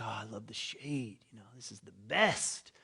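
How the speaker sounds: breathy whispered delight, mock lazy; contented whisper. Jonah enjoying the kikayon's shade, whispered and smug.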